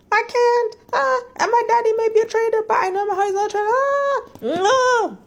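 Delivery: very high, wailing mock melodrama; whiny heroine. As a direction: wail it like an annoying, torn heroine. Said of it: "whiny and annoying. She's like".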